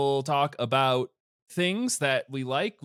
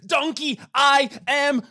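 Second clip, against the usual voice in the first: high, loud ogre yell; Shrek shout; 12 dB louder than usual. Pretend. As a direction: bellow it like a furious ogre.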